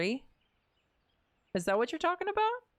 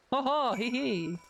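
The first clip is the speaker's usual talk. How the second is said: mock silly giggle; 3 semitones higher than usual. Voicing NPC's silly laugh.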